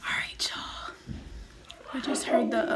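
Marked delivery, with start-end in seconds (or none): 0.1-0.9 hushed whisper so he won't hear. Real, not acted. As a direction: whisper it low. Hiding, setting up the prank.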